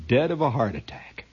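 ordinary delivery

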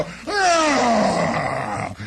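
long falling mock yell; riders' yell. GIs clinging to the back of a swerving truck, long mock yell. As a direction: yell like you're hanging off the truck. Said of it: "We go".

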